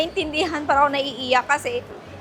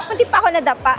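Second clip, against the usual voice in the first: laughing through the words after a near trip; real laughter mid-sentence; 8 semitones higher, much faster than usual. Real, not acted. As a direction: giggle it out, relieved. Nearly tripped, laughing.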